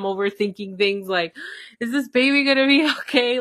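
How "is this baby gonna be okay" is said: worried fret. Retold or imitated. Anxious recalled thought.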